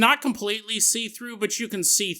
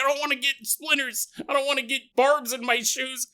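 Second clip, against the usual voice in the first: fussy soldier; 7 semitones higher than usual. Retold or imitated. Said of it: "I was like".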